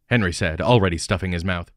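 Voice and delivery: usual speaking voice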